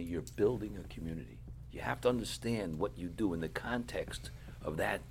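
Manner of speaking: low, quiet quoted teaching; soft, measured correction of a student; soft-spoken rebbe. Retold or imitated. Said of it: "he spoke very softly".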